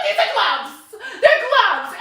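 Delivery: laughing, high and loud insisting. Retold or imitated. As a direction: laugh it out, pointing at the obvious. Showing gloves, mocking customers.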